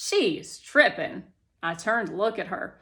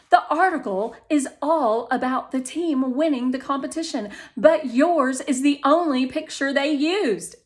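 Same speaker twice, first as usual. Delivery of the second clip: higher and louder, bright proud mother; proud mom; 4 semitones higher, 8 dB louder than usual. Acted. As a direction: mom gushing to her daughter. Mom's voice while reading.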